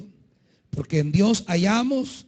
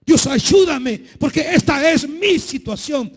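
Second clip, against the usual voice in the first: higher and louder, urgent; fervent preaching; 8 semitones higher, 5 dB louder than usual. Acted. Fervent preaching, voiced prayer.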